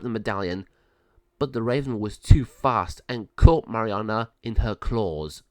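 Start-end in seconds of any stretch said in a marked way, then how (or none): none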